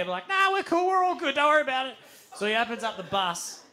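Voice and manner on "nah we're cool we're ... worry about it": high-pitched, louder brush-off; overeager reassurance. Retold or imitated. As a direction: wave the worry away in an acted high voice. Brushing off the bus driver's warning.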